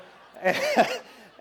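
high, loud burst of laughter; real laugh. Real, not acted. Preacher amused at blanking on a word, laughing. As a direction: laugh out loud at your own slip.

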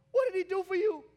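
high, loud preacher's demand; real preaching shout; shouted question. Real, not acted. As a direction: throw the question hard at the congregation.

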